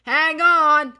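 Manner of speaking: very high, loud warning; full-voiced storybook shout; urgent shout. A narrator's reading. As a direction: shout to passengers to brace. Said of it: "shouted Aisha".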